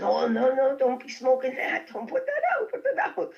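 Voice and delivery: high, loud mock scolding; hammed-up alarmed Frank telling her off; laughing squeal of protest. Frank scolding about joint.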